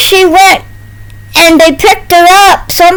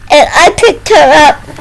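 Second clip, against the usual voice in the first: usual speaking voice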